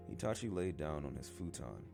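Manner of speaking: ordinary delivery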